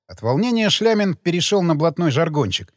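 plain reading voice

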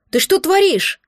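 high, loud panicked yell. A narrator's reading. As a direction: scream it at him in shock. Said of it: "Я завопила".

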